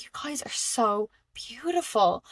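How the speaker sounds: slow, hushed wonder; awed whisper. Retold or imitated. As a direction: whisper it slowly, marvelling at them. Acted awe at star beings.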